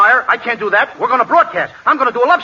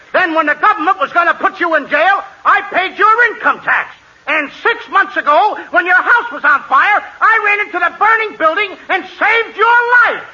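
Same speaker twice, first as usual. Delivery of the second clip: rising higher with each favour; building indignation; 5 semitones higher than usual. Acted. Friend lists favours done for butcher, indignation building. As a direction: build to an impassioned, high-pitched climax.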